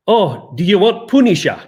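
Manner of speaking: hammed-up Scottish accent. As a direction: imitate a Scottish comic-shop guy. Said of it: "And he would say".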